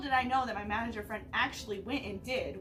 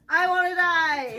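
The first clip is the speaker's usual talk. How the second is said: mock despair; 6 semitones higher, 11 dB louder, slower than usual. Joking after bad pun.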